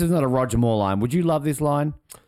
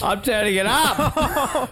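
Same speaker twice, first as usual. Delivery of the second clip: teasing laugh; 9 semitones higher, slower than usual. Real, not acted. Teasing co-host over the music.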